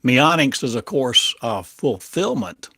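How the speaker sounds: normal talking voice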